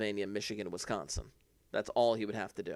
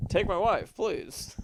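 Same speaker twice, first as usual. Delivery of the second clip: higher, louder comedian's one-liner punch; Rodney Dangerfield; 9 semitones higher, 4 dB louder, slower than usual. Retold or imitated. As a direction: deliver it like a borscht-belt comic. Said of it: "I was about to go into a Rodney Dangerfield routine".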